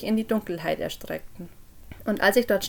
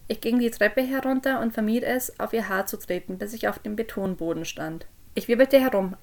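plain reading voice

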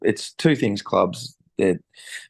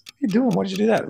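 much higher, shrill shocked cry of disbelief; shocked bystanders; 12 semitones higher, much faster than usual. Acted. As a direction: play the startled onlookers, pitch way up. Joke characters shocked at teabox throw.

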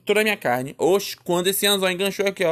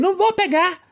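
high, acted-out angry refusal; defiant refusal; 12 semitones higher, 3 dB louder than usual. Child refusing mother's fishing rod, acted defiance.

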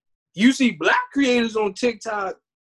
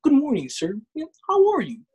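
higher-pitched suit-and-tie politeness, hammed up; mock Harvard graduate; 5 semitones higher than usual. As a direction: put on a proper professional greeting, pitch swooping up. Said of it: "I got a Harvard graduate suit and tie".

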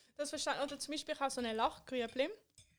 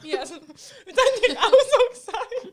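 loud laughing talk; 10 semitones higher, 18 dB louder than usual. Real, not acted. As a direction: burst out laughing as you speak. Joking over Parmigiano.

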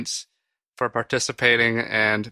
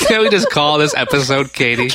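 laughing; 8 dB louder than usual. Real, not acted. Laughing fit continues.

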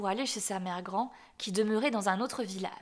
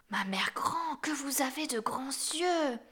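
hushed astonishment; 4 semitones higher, slower than usual. A narrator's reading. Child marvels at wolf's eyes.

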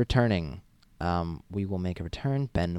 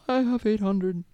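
mock whine; 12 semitones higher than usual. Real, not acted. Complaining about follower count.

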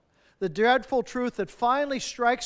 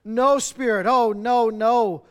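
loud, desperate protest to the spirit; crying out; 7 dB louder, slower than usual. Acted. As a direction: cry out in dread, louder. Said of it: "he cried".

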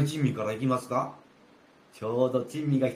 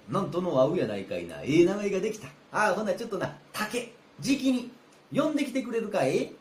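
normal talking voice

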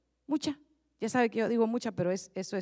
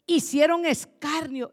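usual speaking voice

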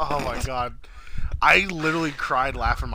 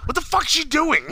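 baffled outburst; 14 semitones higher than usual. Retold or imitated. Belushi's baffled outburst retold.

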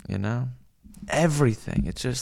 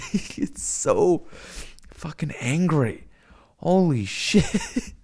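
higher pitched, slow words broken by laughter; can't stop giggling; laughing; 6 semitones higher, slower than usual. Real, not acted. Telling chat about the angry streamer, laughing.

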